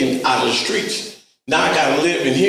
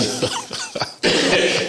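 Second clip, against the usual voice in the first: high breathless laugh; airy high-pitched laughter. Real, not acted. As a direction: burst into a squeaky laugh. Laughing at own story.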